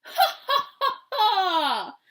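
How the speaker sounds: loud, high villain's "ha ha ha"; big evil laugh. A narrator's reading. Dinosaur gloating over the trap, big evil laugh. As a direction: cackle big and wicked, falling at the end. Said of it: "sounded like a big evil laugh".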